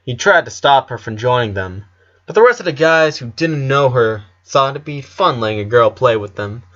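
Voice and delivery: usual speaking voice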